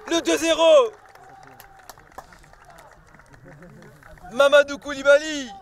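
shouted goal call, high and loud; excited sports commentary. Real, not acted. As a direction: yell the goal with joy.

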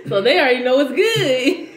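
laughing through the words; amused, chuckling as she talks. Real, not acted. Laughing about kids eating chips.

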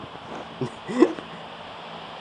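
high, airy wheezing laugh; unvoiced high-pitched wheeze of laughter; breathless laugh. Real, not acted. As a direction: let out a silent, breathy giggle. Laughs at something on screen.

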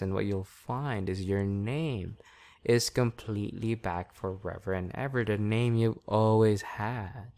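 steady, soothing trance patter; hypnotic induction. Real, not acted. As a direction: ease them down into trance.

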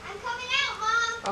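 excited. Pretend. Child in costume announcing entrance.

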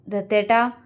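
ordinary delivery

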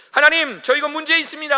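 high, loud mock plea to God; pleading to God. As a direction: cry up to God with the problem. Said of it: "하고 하나님께 던져버리는 거".

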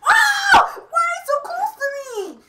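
high, loud, teary yell; startled shout. Real, not acted. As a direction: jump and yell. Character suddenly in face.

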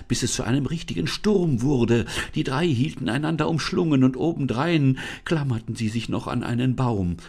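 plain reading voice

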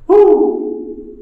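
sudden high excited whoop. Real, not acted. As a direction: let out a delighted woo. Exploring bunker, sudden exclamation.

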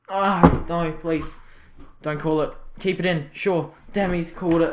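urgent, high plea collapsing into defeat; desperate pleading. Real, not acted. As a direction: beg the referee not to end it. Pleads as final whistle looms.

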